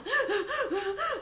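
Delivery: high, nervous panicked squeal; mock panic yelp. A swimmer squealing as water reaches her face, panicked. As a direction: squeal like you're scared of the water. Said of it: "I went into a panic".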